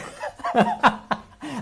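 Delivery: bright burst of laughter; high laugh. Real, not acted. Laughter during theatre story.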